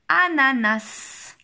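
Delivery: very slow, syllable-by-syllable test word; drawn-out, careful dictation. Shown as a demonstration. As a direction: stretch each syllable out for the pupil. Teacher calls out test word.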